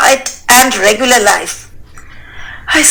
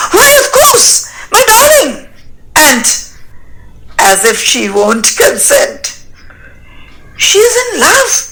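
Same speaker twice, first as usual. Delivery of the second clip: delighted laughing; 7 semitones higher than usual. Acted. Father delighted at the proposal.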